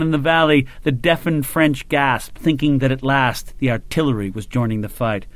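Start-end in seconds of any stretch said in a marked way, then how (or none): none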